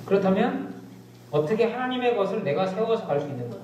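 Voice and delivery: ordinary talk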